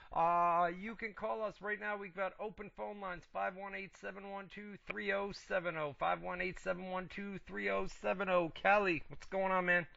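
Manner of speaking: ordinary delivery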